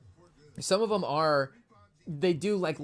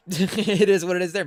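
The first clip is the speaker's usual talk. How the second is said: louder laughing repeat; 4 semitones higher, 9 dB louder than usual. Shown as a demonstration.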